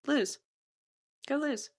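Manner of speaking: high, soft, teasing order; small high voice, short pauses between orders; soft command. Retold or imitated. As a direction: imitate a lady quietly daring him. Imitating her cool command.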